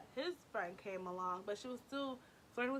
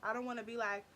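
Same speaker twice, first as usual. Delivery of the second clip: ordinary delivery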